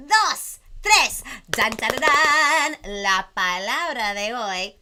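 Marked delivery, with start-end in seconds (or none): 1.5-2.8 a short vocal drum roll that gets louder; mouth drum roll. Pretend. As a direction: drum it out with the voice, building. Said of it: "a drum roll on the count of tres".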